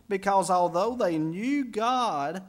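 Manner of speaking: slow, rising through knew God; slow emphatic knew God; stressed scripture phrase. Shown as a demonstration. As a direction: draw out Because although they knew God. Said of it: "did you catch that?".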